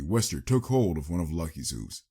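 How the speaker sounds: ordinary delivery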